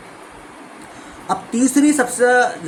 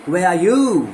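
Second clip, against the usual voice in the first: you louder at the end; stress on you; 4 dB louder, much slower than usual. Shown as a demonstration. Teacher giving the third stress version. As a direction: push the last word you. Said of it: "तीनों में different meaning".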